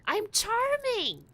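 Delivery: high, slow, drawn-out whine; exaggerated insistence. Real, not acted. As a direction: stretch CHARMING up high, then let it fall. Insisting harder, second take.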